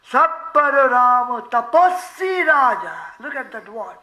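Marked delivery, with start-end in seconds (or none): 0.1-2.9 loud, sustained chant line; devotional verse recitation. Real, not acted. Preacher quotes scripture on Ram as ascetic king, chanted recitation. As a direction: intone the verse with full voice.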